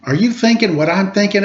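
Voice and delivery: spring the idea on your brother; excited. Acted. Drew asking his twin about the dog idea, eager and quick.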